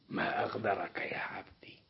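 soft, low whisper of reproach; whispered rebuke. Acted. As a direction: hush it like a disappointed master. God rebukes the servant.

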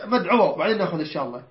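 ordinary talk

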